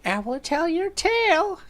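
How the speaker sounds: put-on very high-pitched cackly old-lady voice; crazy old lady. Crazy old lady offers a tale, shrieky high crone. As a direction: squeal it like a batty crone. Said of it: "Fucking crazy old lady".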